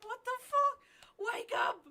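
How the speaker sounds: high, loud, drawn-out yell; panicked shout. Acted. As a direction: shout at yourself to wake up. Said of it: "She's like".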